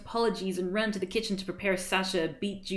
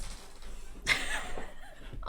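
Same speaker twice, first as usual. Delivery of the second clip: high laugh; 7 dB quieter than usual. Real, not acted. Breaks up laughing.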